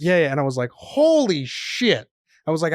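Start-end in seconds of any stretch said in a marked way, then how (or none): 0.7-2.1 stunned holy shit. Retold or imitated. Shocked at finding weed.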